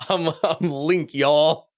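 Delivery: mock Southern inner voice; Southern accent. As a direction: play at a Southern drawl, teasing. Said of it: "you have this Southern accent".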